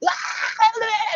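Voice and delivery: high-pitched acted shriek; long, high mock scream; witch's scream. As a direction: screech like an enraged witch. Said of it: "she started screaming".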